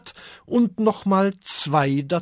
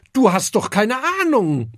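offended grumble; 7 semitones higher, 5 dB louder than usual. Acted. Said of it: "mault der Affe beleidigt".

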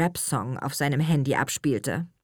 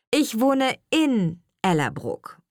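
slow model sentence, "in" stressed; teacher spelling out "in" with weight; correcting stress on IN; 7 semitones higher, 3 dB louder, slower than usual. Acted. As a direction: lean on "in" to show the missing word. Teacher corrects missing small word.

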